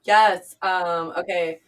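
ordinary talk, nothing marked

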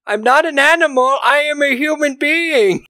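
husband exploding at his wife over the hidden medicine; set off, furious. Retold or imitated. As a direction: blow up at the wife in a hammed-up rage. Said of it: "That sets him off".

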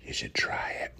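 slow, hushed whisper; playful, very soft whisper. Retold or imitated. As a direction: coax them in a whisper. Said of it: "Whispering is fun".

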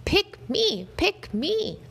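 high, excited repeated begging; Dog begging the important people to pick him; eager plea. A narrator's reading. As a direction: beg over and over, pitch way up.

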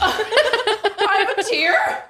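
slow, high, laughing disbelief. Real, not acted. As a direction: giggle out the question. Laughing at burning eyes.

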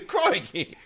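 high, laughing faux-English exclamation; bad English accent through a laugh; attempted English accent. Host retries the accent for friends, laughing fake English. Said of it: "I can't do an English accent".

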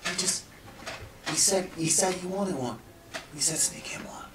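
soft, hushed sneaky voice; whisper. Acted. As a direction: keep it secret, barely voiced. NPC keeps up secret whisper.

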